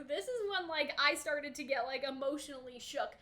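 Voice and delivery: usual speaking voice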